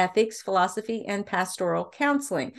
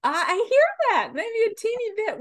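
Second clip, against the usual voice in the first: pleasantly surprised; 13 semitones higher than usual. Real, not acted. Host reacts to Australian birth.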